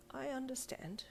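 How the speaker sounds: soft, slightly wobbly voice. Acted. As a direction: let the voice waver a little. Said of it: "with a slightly wobbly voice".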